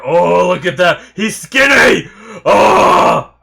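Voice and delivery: loud, high thrilled yelling; excited shout. Real, not acted. Viewer cheering Fat Gum's transformation, excited shouting. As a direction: build to a real shout of amazement.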